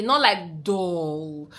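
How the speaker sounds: very slow, droning dull delivery; mock dull voice. Shown as a demonstration. Vlogger to men, demoing a slow dull voice. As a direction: drag it out like you're bored. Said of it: "try as much as possible to speak calmly".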